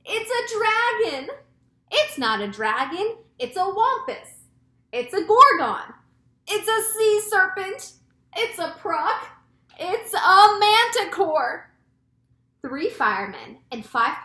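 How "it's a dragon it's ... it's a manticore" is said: high, loud alarmed cries; excited high-pitched yelling; crowd shouting. A narrator's reading. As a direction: call out each guess as a panicked shout. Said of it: "all started shouting at once".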